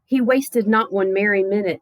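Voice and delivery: usual speaking voice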